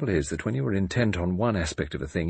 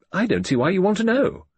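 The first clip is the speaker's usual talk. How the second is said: higher, louder, indignant rise; sharp retort; 11 semitones higher, 4 dB louder than usual. A narrator's reading. Judith retorts to her father, higher and indignant. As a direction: throw his question back.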